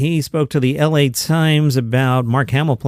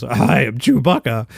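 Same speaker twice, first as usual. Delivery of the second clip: low, slow, gravelly mock Wookiee growl; weird Chewbacca voice; 4 semitones lower, slower than usual. Actress showing Mark Hamill her weird gravelly Chewbacca. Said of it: "in a weird, gravelly voice goes".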